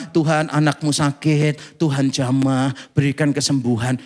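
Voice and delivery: a hint of a dull pastor's prayer; plain pastor prayer. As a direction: pray like a routine pastor, low and mild. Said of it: "nadanya pendeta biasa".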